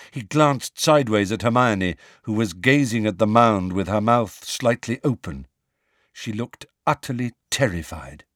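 usual speaking voice

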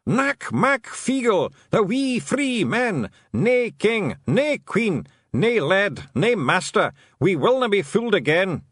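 high, loud Scottish clan chant; defiant little clansmen's rallying cry; Feegle battle cry. Acted. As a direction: proclaim it slowly, swords in the air. Feegles shout their battle cry.

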